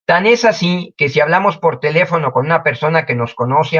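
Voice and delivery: ordinary talk, nothing marked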